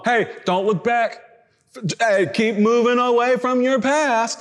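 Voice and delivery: slow, drawn-out church exhortation on "don't look back"; heavy mock emphasis. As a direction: imitate an emphatic preacher, stretching "keep moving away from your past". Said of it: "we put a heavy emphasis, a massive emphasis on".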